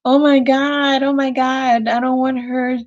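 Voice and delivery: lightly acted frustrated outburst. Reader voices a man afraid of losing her, frustrated. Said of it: "Frustrated, that makes sense to me".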